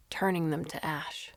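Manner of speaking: usual speaking voice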